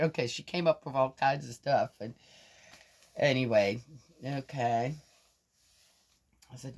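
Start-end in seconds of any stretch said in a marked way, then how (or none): none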